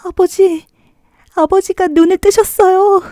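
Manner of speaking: high, bright overjoyed daughter; joyful cry. Acted. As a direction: cry out to him in joy. Daughter crying out to father.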